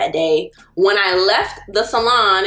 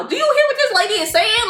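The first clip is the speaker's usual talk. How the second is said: much higher, exasperated plea; appeal to mama; 11 semitones higher than usual. Retold or imitated. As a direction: pitch it up high in disbelief. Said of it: "I'm telling my mama".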